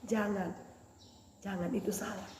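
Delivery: soft Holy Spirit warning; voice of conscience. Acted. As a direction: quietly warn like the Spirit within. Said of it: "Tapi Roh Kudus lagi katakan".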